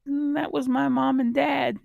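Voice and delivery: lightly voiced young girl; child's voice. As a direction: speak up high like a little girl answering. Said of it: "she says".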